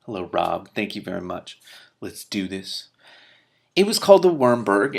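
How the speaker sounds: ordinary delivery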